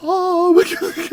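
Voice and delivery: stretched-out mock swoon; pretend overwhelmed delight. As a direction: act blown away, slow and loud. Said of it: "be instantly like".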